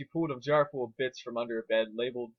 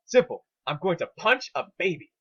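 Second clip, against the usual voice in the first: eager announcement; 5 semitones higher, 6 dB louder than usual. A narrator's reading. Twilight announces plan.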